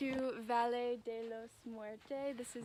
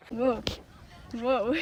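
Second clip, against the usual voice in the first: whoa; 6 dB louder than usual. Real, not acted. Atop a tall sand dune.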